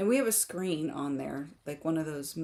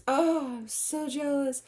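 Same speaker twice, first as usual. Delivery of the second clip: playful envy; 8 semitones higher, 4 dB louder, slower than usual. Real, not acted. Reacting to fall mornings.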